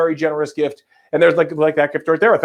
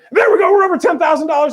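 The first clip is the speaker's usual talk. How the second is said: much higher, loud celebration; excited cheer; 12 semitones higher, 4 dB louder, faster than usual. Real, not acted. Host hitting ten thousand dollars, excited cheer. Fundraising total passes milestone.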